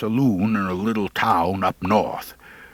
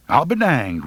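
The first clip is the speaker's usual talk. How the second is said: mild surprise; 5 semitones higher than usual. Acted. Wretch amazed.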